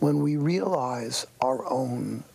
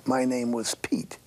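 ordinary delivery

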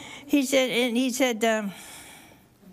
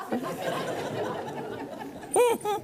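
short, high, breathy chuckle; real amused chuckle; breathy laugh; 12 semitones higher, 5 dB quieter than usual. Real, not acted. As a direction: chuckle at the thought. Laughs at the flack.

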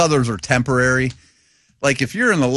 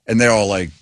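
normal talking voice

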